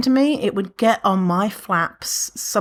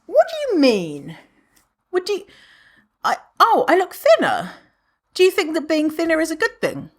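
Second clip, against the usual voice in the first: pointed question; 8 semitones higher than usual. Retold or imitated. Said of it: "That's always my response".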